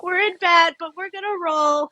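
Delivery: high, put-on pleading voice; mock-plaintive reply. Sick client answering gym trainer, mock-plaintive.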